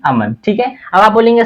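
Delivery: ordinary talk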